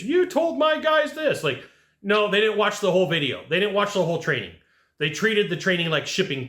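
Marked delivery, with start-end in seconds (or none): 0.0-1.4 high, slow mock complaint; angry client message. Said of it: "a message".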